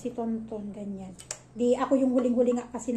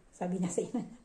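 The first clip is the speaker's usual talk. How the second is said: ordinary talk, nothing marked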